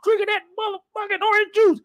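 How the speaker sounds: high mock angry dad yell. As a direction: bark at the kids over the fridge. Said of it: "my dad yelling".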